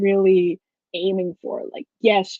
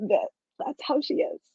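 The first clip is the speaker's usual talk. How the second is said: ordinary talk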